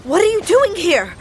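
high, loud scolding demand; angry shout. Acted. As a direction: yell at her in exasperation. Confronting the stowaway daughter.

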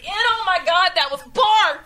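disgusted. Real, not acted. Reacting to vomit flavor bean.

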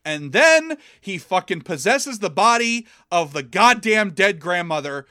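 normal talking voice

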